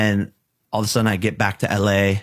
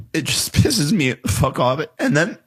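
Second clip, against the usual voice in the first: choking up; 6 semitones higher than usual. Real, not acted. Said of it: "You're good. Take a break".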